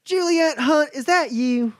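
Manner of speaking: high, ringing call from across the hall; mayor calling out to an old friend. Acted. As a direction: shout a surprised greeting into the lobby. You hear a voice ring out.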